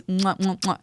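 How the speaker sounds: string of showy air kisses; mock kisses. Storyteller joking about crying before trees, playful kiss sounds. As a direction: blow quick, playful "mwah" kisses.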